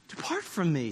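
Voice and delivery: low, soft humbled plea; breathless awe. Acted. Simon on his knees before Jesus, breathless. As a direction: say it hushed, as if winded. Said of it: "His breath's gone".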